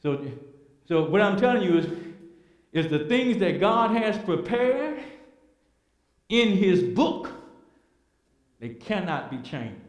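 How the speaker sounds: normal talking voice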